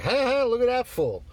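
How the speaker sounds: high, loud jeering laugh-voice; mocking kid. Young clerk jeering at the angry customer, high and loud. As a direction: play the kid laughing at a fool. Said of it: "he's like".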